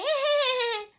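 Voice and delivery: high imitated cackle; mocking laugh. Imitating hecklers copying her laugh, mocking laugh. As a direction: fake high giggle, imitating them. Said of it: "they'd imitate".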